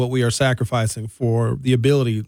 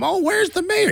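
whiny mock complaint. Mocking people who want the mayor.

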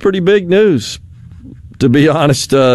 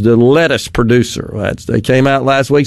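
usual speaking voice

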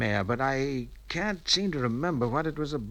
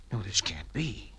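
hushed disbelief; 6 semitones lower, 9 dB quieter than usual. Acted. Shocked by what he reads.

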